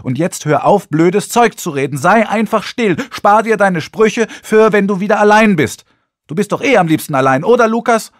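loud, high-pitched angry tirade; furious scolding. A narrator's reading. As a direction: build to a loud, high, cutting demand for silence. Tirade peaks, orders him silent.

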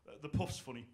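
lightly voiced dad, low and soft, "the puff's funny"; a hint of a tired dad mumbling at the telly; imitating dad. Tired dad commenting on a sitcom, lightly imitated low mumble. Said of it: "that was how to say it".

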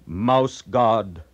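usual speaking voice

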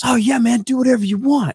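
high, eager go-ahead voice; agreeable announcer. Retold or imitated. Ring announcer okays the promo idea, imitated breezy agreement.

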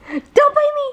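high squealing laugh, don't bite me. Real, not acted. Mom squealing with laughter as the baby bites her.

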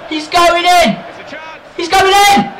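high, loud repeated cry; frantic rising excitement; excited shout. Real, not acted. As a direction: yell as the player breaks through. Excited at an attack.

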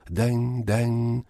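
high ringing bell sound, den den. A narrator's reading. The leper's bell ringing across the square, high and clear. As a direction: ring it out like a clear little bell.

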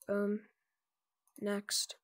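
ordinary delivery

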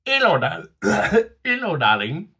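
high, loud mock flirty "hello darling"; the beer flirts with you, hammed up; seductive greeting. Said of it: "and says".

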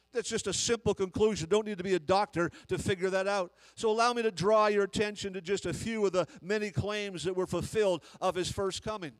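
loud fervent preaching; full-voiced pulpit preaching; preaching at full force. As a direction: build it like a revival sermon.

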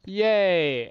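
high, loud yay; happy cheer. Real, not acted. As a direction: cheer as the plan works. Cheering villagers falling in.

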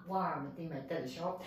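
usual speaking voice

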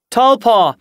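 high, loud summons; calling out. A narrator's reading. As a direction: call across the camp to someone. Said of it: "Dawnstripe called from the camp entrance".

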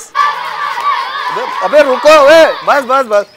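loud high yell of stop; frantic calling out; shout to stop. Real, not acted. Stopping kids chasing the goat.

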